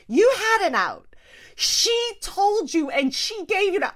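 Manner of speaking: high, loud mock scolding; emphatic mock retort. Family telling husband he had an out, loud mock retort.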